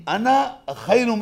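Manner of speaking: high, loud, slow and drawn out; Iblis's proud claim. Retold or imitated. Iblis proudly refusing God's command, in a loud, slow boast. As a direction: stretch it out like an arrogant boast. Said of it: "تو اس نے reason دی".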